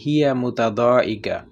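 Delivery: slow and careful; slow demo. Shown as a demonstration. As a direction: model it for learners. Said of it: "اگر کہنا ہو". (آہستہ مثال)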